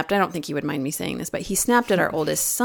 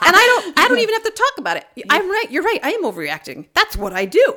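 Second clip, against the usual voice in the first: laughing mock voice; 12 semitones higher, 4 dB louder, faster than usual. Laughing, mocking her own overreaction.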